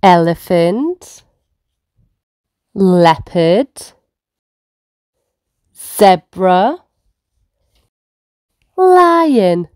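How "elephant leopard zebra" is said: ordinary talk, nothing marked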